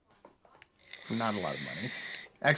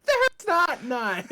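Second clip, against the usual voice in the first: very high, slow, laughing whine; whiny bard voice; 4 dB louder, much slower than usual. Retold or imitated. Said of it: "the bard went".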